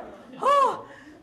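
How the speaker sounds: a high, loud gasping laugh; breathless delight at the news; excited high gasp. Real, not acted. Reliving Oprah's office calling.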